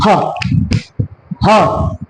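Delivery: ह ह said alone as an open-mouthed breath; demonstrating the glottal ह; breathy demo sound. Shown as a demonstration. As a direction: sound out ह from the throat. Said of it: "ह की बात करें हम".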